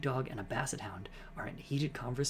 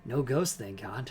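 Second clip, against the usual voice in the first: normal talking voice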